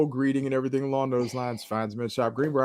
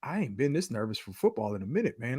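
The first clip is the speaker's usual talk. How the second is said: retold nervous friend; 2 semitones lower, 11 dB quieter than usual. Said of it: "He's like".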